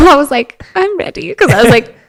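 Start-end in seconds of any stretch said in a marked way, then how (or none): 0.7-1.3 small, high-pitched voice; lightly mock your feeble younger self; retold meek surrender. Sick daughter in bed answering supporters, mock meek.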